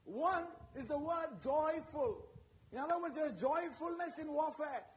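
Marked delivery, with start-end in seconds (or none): 0.1-2.3 slow, weighted reading; highlighting. Shown as a demonstration. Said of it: "what I want to highlight".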